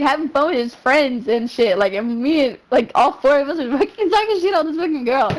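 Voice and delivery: ordinary talk, nothing marked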